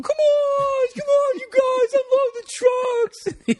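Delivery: screechy, drawn-out falsetto yell; mocking boss impression. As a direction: parrot him in a slow, very high shriek. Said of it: "do this impression of him back".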